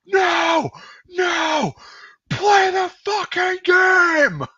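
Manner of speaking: high, drawn-out holler of outrage; imitating a furious, long-range bellow; imitated Waz yell. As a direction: scream it as if across a field. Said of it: "you just hear from across the fucking field".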